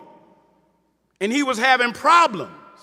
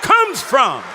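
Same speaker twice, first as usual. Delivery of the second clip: preaching at full force. Acted. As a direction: proclaim it to the whole hall. Preaching about the book.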